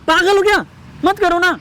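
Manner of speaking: high-pitched agitated pleading; alarmed protest. Acted. Husband begs wife to stop calling people, alarmed protest. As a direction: protest urgently, voice jumping up.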